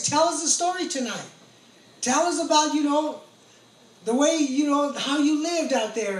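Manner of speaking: loud, high request for a story; pleading call. Retold or imitated. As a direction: call up begging for tonight's tale. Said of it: "inmates asking for a story".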